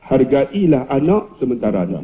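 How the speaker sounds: low, earnest advice. Acted. Principal advises teacher to cherish children, low sincere tone. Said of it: "dia nada rendah".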